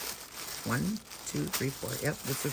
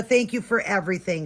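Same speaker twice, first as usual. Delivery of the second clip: ordinary talk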